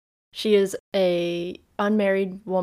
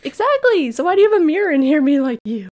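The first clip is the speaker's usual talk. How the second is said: much higher, louder, fast gossipy rant; indignant outburst; 8 semitones higher, 9 dB louder, faster than usual. Retold or imitated. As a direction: burst out in exasperated disbelief. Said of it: "I was like".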